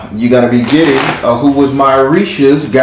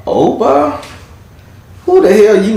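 surprised; 10 semitones higher than usual. Real, not acted. Surprise at card drawn.

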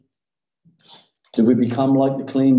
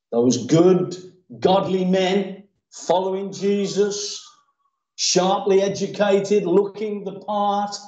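slow, spaced-out phrases; measured preaching; 7 semitones higher, slower than usual. Real, not acted. Preacher describing the respectable followers, slow and pointed. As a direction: set out each description deliberately.

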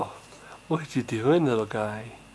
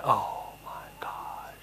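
whisper; 4 semitones lower, 6 dB quieter, much slower than usual. Real, not acted. Marveling at the baby.

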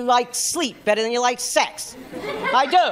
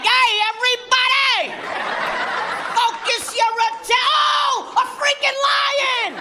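hammed-up Brooklyn guy startled by a lion; Brooklyn accent; 12 semitones higher than usual. Comic playing a Brooklyn tour guide in Nigeria, high shouting Brooklyn accent. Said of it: "it's Vinny from Brooklyn. He's like".

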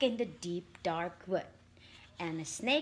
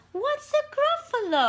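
startled question; 13 semitones higher, 4 dB louder than usual. Acted. Snake asks, startled.

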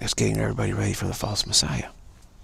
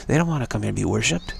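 ordinary delivery

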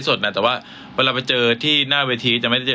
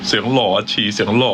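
handsome voice; 4 semitones lower than usual. Acted. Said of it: "เสียงหล่อชิ".

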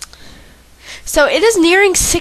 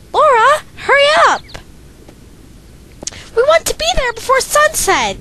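high, loud call to a friend; voicing a girl calling; 12 semitones higher, 3 dB louder than usual. Game girl shouting for Laura to hurry, high and loud. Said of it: "why do I feel the need to do voices".

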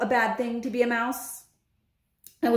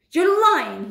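loud, high accusation; outraged accusation; 9 semitones higher, 6 dB louder than usual. Acted. Bruno to friend who says witches changed him, loud outrage. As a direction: throw it out hotly.

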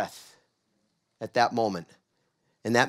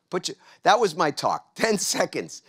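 ordinary talk, nothing marked

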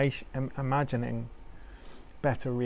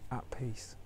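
whispering; 9 dB quieter than usual. Acted. Meditation, whispered phrase.

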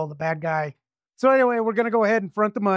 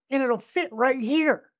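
imitated woman; 6 semitones higher, slower than usual. Female voiced, slow.

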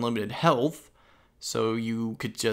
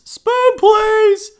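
silly high voice; 12 dB louder than usual. Pretend. Voicing a jawbreaker slogan.

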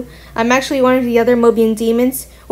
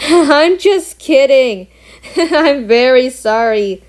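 laughing admission; slower than usual. Acted. Demon laughs off teasing the bat, amused and laughing. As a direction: laugh through it, slow and drawn-out.

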